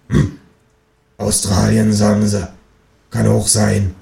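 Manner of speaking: a hint of a nosy old neighbour; lightly voiced neighbour. Neighbour lady gossiping on the stairs, lightly voiced. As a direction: gossip in a slightly altered voice.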